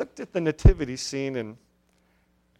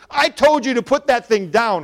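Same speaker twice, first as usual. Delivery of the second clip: loud, sharp, higher scolding; angry mother; 8 semitones higher, 10 dB louder than usual. A narrator's reading. Mother cuts off her daughter in the store, loud angry scolding. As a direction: snap at the child to drop it. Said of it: "her mother interrupted".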